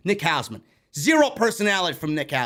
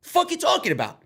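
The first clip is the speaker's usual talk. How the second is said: defiant retort; 9 semitones higher than usual. Retold or imitated. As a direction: fire it back like you own the room. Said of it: "I looked at him like".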